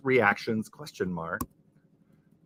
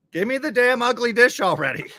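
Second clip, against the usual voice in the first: mock demand; 11 semitones higher, 5 dB louder than usual. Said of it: "Nicole says".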